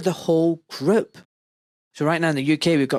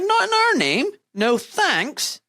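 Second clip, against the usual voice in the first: high-pitched imitation of protesters refusing; emphatic refusal; 13 semitones higher, slower than usual. Quoting student protesters who reject it, emphatic high-pitched refusal. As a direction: voice a firm, raised-pitch protest slogan.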